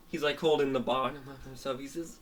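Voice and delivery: ordinary delivery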